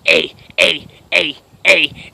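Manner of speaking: loud, abrupt barks; short, punched, repeated shouts; barked. Retold or imitated. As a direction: bark out each letter separately. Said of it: "I just barked it in her face".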